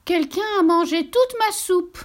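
put-on squeaky baby bear cry; little bear voice. A narrator's reading. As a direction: play the baby bear upset.

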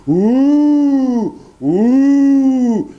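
very high, loud wolf howl; wolf fleeing, howling with pain. A narrator's reading. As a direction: howl out the burn. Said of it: "en hurlant".

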